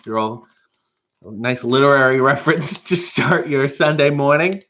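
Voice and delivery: normal talking voice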